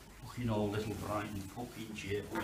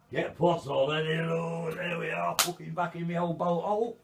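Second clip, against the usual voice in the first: gleeful cheer; 8 semitones higher, 10 dB louder than usual. Real, not acted. The man cheers as he gets back to his bolt hole. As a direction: crow with relief at being home. Triumphant return home.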